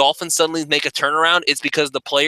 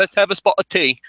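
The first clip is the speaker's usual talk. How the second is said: higher-pitched mock London accent; bad British accent; 5 semitones higher than usual. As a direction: put on a terrible British accent. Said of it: "that's a terrible accent".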